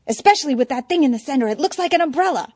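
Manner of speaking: high, fast excited chatter. Acted. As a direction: rush it, voice up high. Eddie excited over picture.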